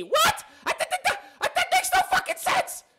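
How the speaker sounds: high, squeaky sputter of disbelief; incredulous outburst. Real, not acted. Commentator reacting to a baffling claim, incredulous. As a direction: stammer it out high-pitched, scandalized.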